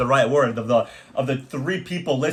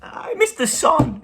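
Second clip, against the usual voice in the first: high, slow mopey whine, hammed-up; mock sad voice; much slower than usual. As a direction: ham up being sad, pitched way up. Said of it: "I do it sad".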